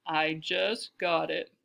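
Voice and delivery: slow, stately relish; unhurried, refined and self-pleased; deep, cultured voice. A narrator's reading. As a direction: say it slowly as a posing matron. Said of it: "her deep, cultured voice".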